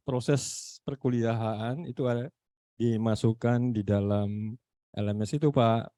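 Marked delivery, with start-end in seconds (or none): none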